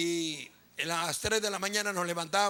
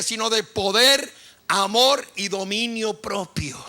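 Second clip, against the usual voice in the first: loud, raised preaching voice; full-force preaching; 2 semitones higher, 9 dB louder than usual. Real, not acted. Pastor proclaiming scripture to the congregation, full-force preaching.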